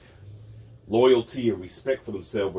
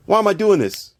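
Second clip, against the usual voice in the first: ordinary talk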